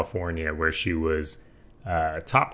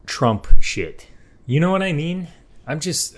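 fed-up outburst; 8 semitones higher, 7 dB louder than usual. Real, not acted. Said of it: "so sick and tired of".

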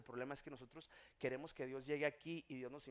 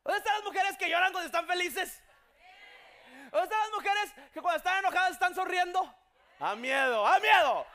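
loud, high, impassioned sermon; full-force preaching; 11 semitones higher, 13 dB louder than usual. Acted. Preacher declaring God's miracles, loud full-force preaching. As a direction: proclaim it to the whole congregation.

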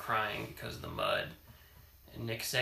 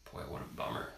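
ordinary delivery, nothing marked

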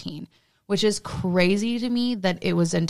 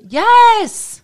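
high, loud cry of confirmation; emphatic yes; 13 dB louder than usual. Real, not acted. Insisting to a doubtful co-host, emphatic shout.

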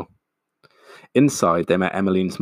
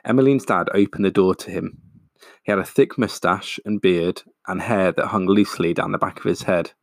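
ordinary delivery

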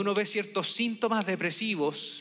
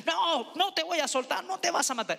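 firm aunt; 9 semitones higher than usual. Retold or imitated. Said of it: "lo agarró firme".

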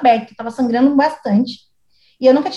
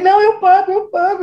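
anxious apology; 7 semitones higher than usual. Retold or imitated.

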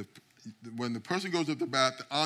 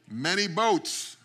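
put-on slow, loud Jamaican accent; 6 semitones higher, 7 dB louder, much slower than usual. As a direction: drag out "Many boats" loudly in a Jamaican accent. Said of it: "he had this very thick Jamaican accent".

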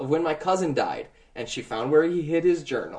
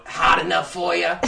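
devil voice; 3 semitones higher, 6 dB louder than usual. Retold or imitated. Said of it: "and I would go".

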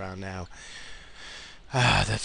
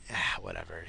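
ordinary talk, nothing marked

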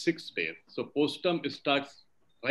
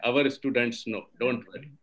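ordinary talk, nothing marked

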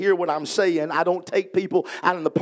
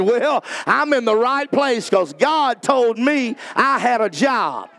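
slow, preacher-style comeback; high, drawn-out smart-aleck boast, falling at the end; 4 semitones higher, 3 dB louder, slower than usual. Pretend. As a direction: play the smart aleck, stretching out the retort. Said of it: "the smart aleck in me".

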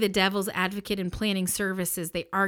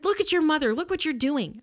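higher-pitched mom reprimand; scolding mom voice; 7 semitones higher than usual. Retold or imitated. Woman scolding brothers beside their mother, higher mom voice. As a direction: tell them off like a mother. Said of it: "some sort of a mom voice".

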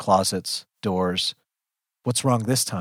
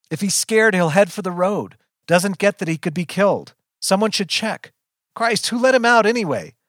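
high, agitated raised voice; full-volume worry; 9 semitones higher, 4 dB louder than usual. A narrator's reading. Narrator frets for the lost dog, raised and frantic. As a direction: keep calling out, frantic and exasperated. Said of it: "full volume".

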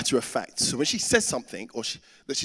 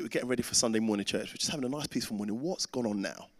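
normal talking voice

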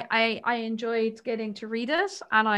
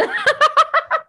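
loud high laugh; 8 dB louder than usual. Real, not acted. Laughing after relief.

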